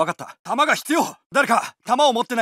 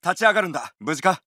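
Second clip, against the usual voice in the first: normal talking voice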